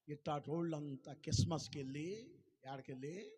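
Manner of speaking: ordinary talk, nothing marked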